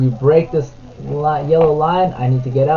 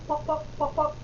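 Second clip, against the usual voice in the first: playful pops; 11 semitones higher, 12 dB quieter than usual. Pretend. Sound effects in picture book.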